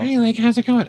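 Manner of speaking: high fairy voice; Sprite voice. Shown as a demonstration. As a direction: pitch it up for Sprite. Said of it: "Sprite's voice is very high".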